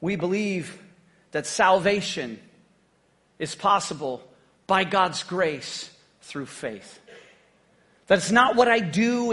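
ordinary talk, nothing marked

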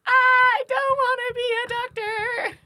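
high, slow whiny wail; whiny protest. Retold or imitated. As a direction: wail it like a reluctant student. Retold protest in class.

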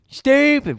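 high-pitched squeal; squeaky high yelp. Pretend. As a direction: squeak the word out. Self-mocking exclamation.

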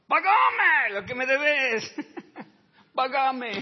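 shouted high, slow and strained with a laugh; a hammed-up furious creditor; furious demand. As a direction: bellow the demand like a choking creditor. Servant choking his debtor.

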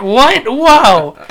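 loud, high mock shock; shocked disbelief. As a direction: act stunned and a bit scandalized. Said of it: "And I'm like".

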